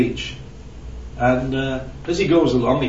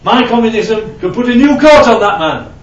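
boastful communist; 8 semitones higher, 8 dB louder than usual. Retold or imitated. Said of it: "the communist looks at him and says".

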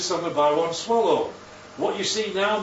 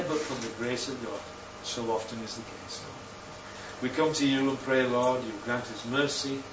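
soft, earnest prayer voice, lower than the sermon; closing prayer; 4 semitones lower, 4 dB quieter than usual. Acted. Preacher leading the church in prayer, hushed and earnest. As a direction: pray quietly, unhurried and sincere.

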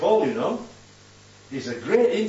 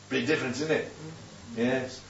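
ordinary talk, nothing marked

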